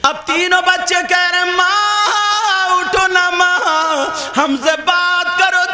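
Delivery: impassioned religious storytelling; fervent preaching. A narrator's reading. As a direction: preach the story at full force to the crowd.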